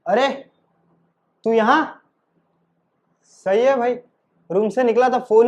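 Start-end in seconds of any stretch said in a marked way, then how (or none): none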